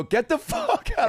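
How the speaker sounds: high, drawn-out outraged cry; imitated angry yell, pitch soaring; mock furious eviction. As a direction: throw him out of the meeting. Brothers throwing him out, retold.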